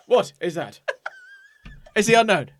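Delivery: robot voice. Retold or imitated. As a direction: play the robot host. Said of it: "That's pretty good".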